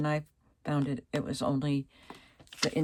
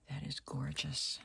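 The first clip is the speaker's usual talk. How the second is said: whispering; 10 dB quieter than usual. Real, not acted. Admiring a pretty tape.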